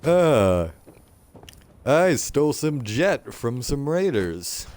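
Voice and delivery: high, swooping comic radio DJ; hammed-up amateur broadcaster from a comedy sketch; radio host. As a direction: play a hapless radio host on air. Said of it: "like, he's running a radio".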